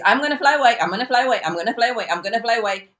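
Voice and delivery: quick, high repeated boast, easing down; chattering birds. Acted. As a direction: rattle it off like birds all talk, no action. Voicing birds that only talk.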